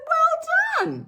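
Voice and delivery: high, loud cheer; excited praise. Retold or imitated. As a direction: congratulate her with a squeal. Own praise to Ma retold.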